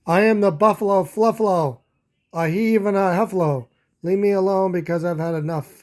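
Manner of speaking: loud, huffy proclamation, fast at the end; grumpy buffalo roaring his name; snarling. Acted. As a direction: puff yourself up and bellow. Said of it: "snarled and growled and stomped".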